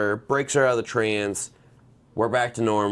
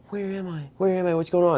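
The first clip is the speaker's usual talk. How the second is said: high-pitched imitation of a confused girl; disoriented daughter; 8 semitones higher than usual. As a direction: act out coming to, high and lost. Voicing dazed daughter in séance.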